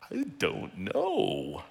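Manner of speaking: slow, hesitant I don't know; doubtful reply. Pretend. A believer doubting God's direction, slow and hesitant. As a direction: drag it out unsure, half-lost. You doubting what God shares.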